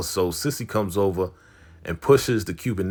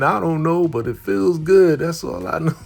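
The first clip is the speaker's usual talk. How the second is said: giddy high; 9 semitones higher, 4 dB louder than usual. Retold or imitated. Big Leon giddy and high.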